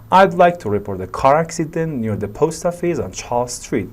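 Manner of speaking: "car accident", "post office", "Charles Street" each picked out firmly; stressed keywords. Shown as a demonstration. Teacher demonstrating an emergency report, stressing "car accident", "post office", "Charles Street". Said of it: "باید استرس داشته باشن".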